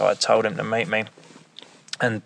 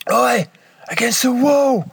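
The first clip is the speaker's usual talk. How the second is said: shouting; 13 semitones higher, 3 dB louder than usual. Retold or imitated. Police shouting at someone.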